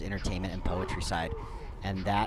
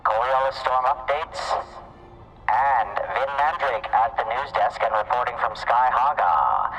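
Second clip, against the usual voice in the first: loud, high news promo voice; news announcer; 4 semitones higher, 4 dB louder than usual. Acted. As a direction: pitch the news like a radio promo. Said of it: "make some announcements".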